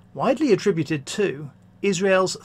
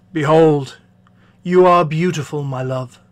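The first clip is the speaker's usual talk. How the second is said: man to his bride; 2 semitones lower, 5 dB louder than usual. Acted. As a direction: declare it as the admiring bridegroom. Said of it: "a dialogue between a man and his bride".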